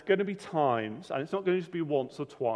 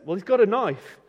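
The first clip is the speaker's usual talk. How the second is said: higher and louder, alarmed; 6 semitones higher, 7 dB louder than usual. Retold or imitated. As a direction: warn him, rattled by the knife. Frightened Sue pointing out knife.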